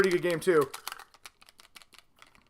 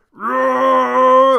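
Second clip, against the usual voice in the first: a loud roaring Thanos growl; monster roar; 5 semitones higher, 9 dB louder than usual. Acted. Thanos doll hits the quarterback, hammed-up roar.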